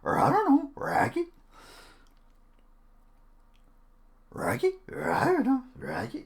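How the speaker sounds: very high, growly cartoon dog voice; Scooby-Doo. Streamer showing off impressions, Scooby-Doo voice. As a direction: turn the first sounds into doggy Rs, squeaking upward. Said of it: "I could do Scooby-Doo".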